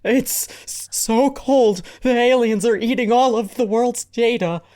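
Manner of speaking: high-pitched, hammed-up shivering voice; pretend freezing. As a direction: stammer it out as if freezing. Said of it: "Oh, [name]'s freezing".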